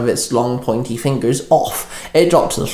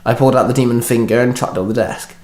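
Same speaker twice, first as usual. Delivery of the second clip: usual speaking voice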